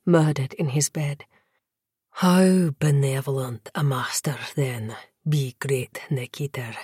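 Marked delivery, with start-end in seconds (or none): 2.2-6.8 whispering vampire lord. Acted. Vampire lord speaks seductively.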